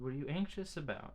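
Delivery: coaxing high question. Retold or imitated. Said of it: "I said".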